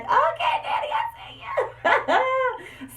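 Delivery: high, slow laughing shout; excited cheer. Retold or imitated. Proud kid shouting to her soldier dad, high and laughing. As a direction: shout it to Daddy across the field.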